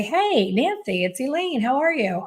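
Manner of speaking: cheery phone greeting. Retold or imitated. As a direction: sing out the greeting like an old friend. Stylist calls a lapsed client.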